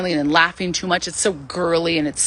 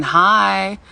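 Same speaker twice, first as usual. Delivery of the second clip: ordinary talk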